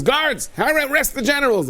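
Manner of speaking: slow, high royal command; king's order. Acted. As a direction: bark the order like an angry king. King commanding guards.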